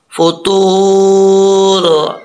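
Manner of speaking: फतूर very slow, a full ता and the वाव held one alif; slow recitation. Shown as a demonstration. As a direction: draw out फतूर. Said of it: "सही तरीके से अदा नहीं". (फतूर बहुत धीरे, ता पुर)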